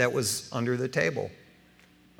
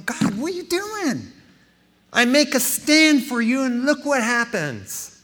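higher, louder plea that slows at the end; mild pleading with God; 10 semitones higher, 4 dB louder than usual. Retold or imitated. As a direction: cry out to God in frustration. Retold crying out to God.

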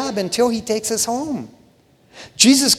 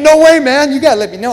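mock protest; 8 semitones higher, 7 dB louder, faster than usual. Acts out guys protesting foot washing.

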